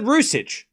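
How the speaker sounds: Rusic ending in ch, hissing s inside; echoing the commentator. Retold or imitated. Streamer repeating the TV commentator's version, echoing the commentator. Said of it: "the guy on the T V just said".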